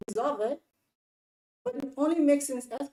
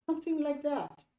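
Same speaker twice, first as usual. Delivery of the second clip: ordinary talk, nothing marked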